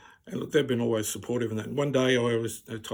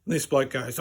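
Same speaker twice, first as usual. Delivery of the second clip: normal talking voice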